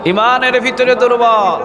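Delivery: high, ringing preacher's cry; impassioned repeat. Real, not acted. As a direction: cry it out high, stretching the end. Repeated loudly for the crowd. (উঁচু গলায় টানা আক্ষেপের হাঁক)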